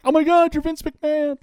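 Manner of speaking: starstruck squeal. Retold or imitated. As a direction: gush like a starstruck fan. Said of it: "Just like".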